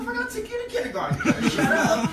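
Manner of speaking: fast, high, loud scolding; big brother scolding little sister; indignant yell. Retold or imitated. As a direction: snap back at her.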